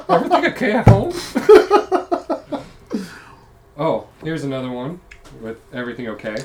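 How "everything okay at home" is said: laughing. Real, not acted. Teasing laughing question.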